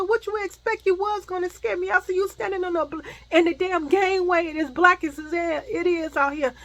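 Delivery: high-pitched, heated protest; indignant scolding. Retold or imitated. Woman scolds the man who scared her, high and indignant.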